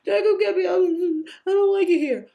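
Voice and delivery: high, loud, tearful wail to Dad; crying child's plea. Retold or imitated. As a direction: beg like a scared little girl calling her father. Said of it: "I'm crying".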